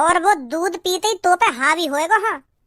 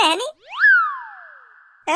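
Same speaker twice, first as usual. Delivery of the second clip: teasing drawn-out question; 10 semitones higher, much slower than usual. Acted. Teasing bride about wedding night.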